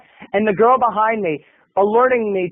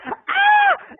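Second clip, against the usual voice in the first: very high-pitched shriek; imitated scream. Girl behind in the movie theater, piercing mock scream. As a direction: let out a sudden mock-terrified scream. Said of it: "the girl screams behind me".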